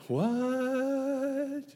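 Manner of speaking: high, baffled squeak of a question; confused Nicodemus. Acted. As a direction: play Nicodemus totally lost.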